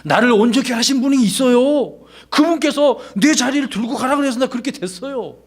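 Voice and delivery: high bright voice of the healed man; healed man's earnest reply. Retold or imitated. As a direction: raise the voice high and speak it like a simple witness. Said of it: "그 이유를 밝히고". (높은 목소리로 담담하게 증언하듯)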